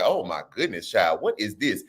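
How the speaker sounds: soft low dismayed aside; quiet mock dismay; hushed shock. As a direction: murmur it in disbelief. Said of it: "So I was like".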